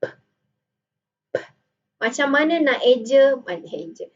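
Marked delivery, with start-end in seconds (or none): 0.0-1.4 isolated "p" with a puff of air; short, voiceless burst of "p"; plosive p. Shown as a demonstration. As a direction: pop the lips on "p". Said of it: "you are producing the".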